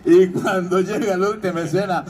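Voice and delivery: usual speaking voice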